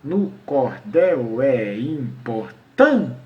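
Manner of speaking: drawn-out scansion with spaced beats; counting syllables. Shown as a demonstration. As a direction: count out each syllable of the verse. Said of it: "então se eu contar aqui".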